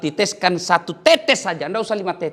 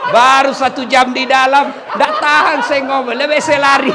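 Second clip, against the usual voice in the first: higher, louder, fed-up laughing shout; exasperated jinn shout; 9 semitones higher, 10 dB louder than usual. Acted. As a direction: blurt out that you can't stand it. Jinn complaining about nagging woman.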